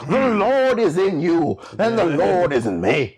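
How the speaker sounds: rolling mock-sermon cadence; Presbyterian preacher. Player riffing for co-hosts, as a Presbyterian preacher. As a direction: preach it in big swoops. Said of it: "in a Presbyterian preacher's kind of voice".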